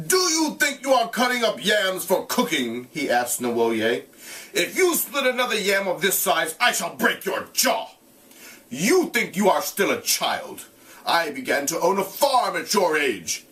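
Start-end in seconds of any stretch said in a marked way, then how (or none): 0.1-2.9 higher, scolding father's voice; stern threat to his son; threatening father. Acted. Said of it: "he said so with much threatening". 4.5-13.5 a hint of a harsh, scolding father; mild voiced threat; threatening father. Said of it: "he said so with much threatening".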